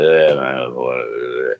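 a wasted rocker answering lazily from deep down; imitating a stoned rocker. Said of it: "And he's like".

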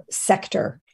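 ordinary talk

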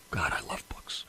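soft fond whisper to himself; whispered aside. Real, not acted. Host to listeners, a fond whispered aside. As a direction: drop to a whisper and share it like a secret. Aside while urging dictionary use.

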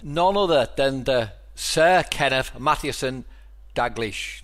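slow, announced build to the name; grand guest introduction. Real, not acted. Host presenting his hero by full title, grand introduction. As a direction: draw out the full title, pause before the surname.

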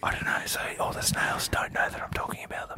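breathy secret whisper. Real, not acted. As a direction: keep it hushed so they can't hear. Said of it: "why are you whispering?".

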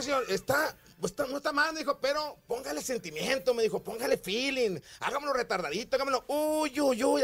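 lightly voiced producer. Producer coaching the singer to add feeling.

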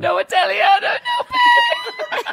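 high shouted mock Italian, laughing; Italian accent shout. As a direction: yell it like a tearful tantrum. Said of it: "And then you need to start crying".

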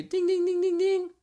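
very high sung dings like a ringing bell; school bell imitation. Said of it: "the bell would ring".

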